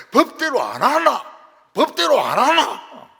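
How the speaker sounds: mock Gyeongsang brawler, high and very loud; Gyeongsang dialect shouting. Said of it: "경상도는".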